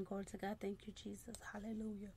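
soft unvoiced prayer murmur; whispered praise. Real, not acted. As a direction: breathe it as a private prayer.

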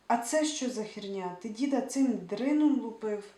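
loud, exasperated demand; a furious shout at the partner in crime; angry outburst. Acted. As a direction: shout at him in disbelief. Seva yells at accomplice.

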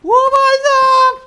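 very high, slow full-force scream; real explosive gamer outburst; screamed amazement. Real, not acted. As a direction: scream it out, stretching every word. Huge reaction to game action.

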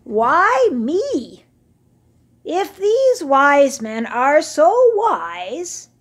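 put-on high, loud, complaining old camel; grumpy old camel. Old camel grumbling to the voices, high and aggrieved. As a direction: whine about your aches like a vain old beast.

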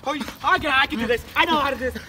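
ordinary talk

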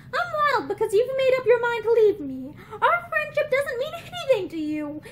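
high, wounded reproach; acted distressed accusation; upset reproach. A narrator's reading. Upset friend reproaches her for leaving.

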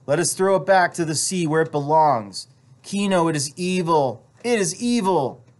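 high, insistent wife's plea; urgent pleading. A narrator's reading. Wife begging husband to destroy the evil pearl, urgent pleading. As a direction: plead earnestly, pitch held up.

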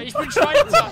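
laut gerufen. Pretend. Ruft Neutralität aus, lacht.

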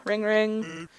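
ordinary talk

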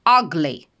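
"Ugly" with a short, fast first vowel; quick short a. Shown as a demonstration. Teacher shows the class the short a in ugly. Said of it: "Швиденько вимовляти треба".

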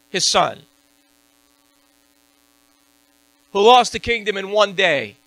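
loud, high fiery preaching; high, loud fervent sermon; preaching at full force. Acted. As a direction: drive it home like a revival preacher. Preaching climax.

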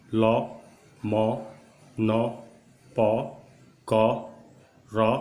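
bold syllables, one by one; steady projected repetition drill; loud, open-throated drill. Shown as a demonstration. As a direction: call them out without hesitation for the class. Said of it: "जोर से बोलिए, दिल खोल के बोलिए".

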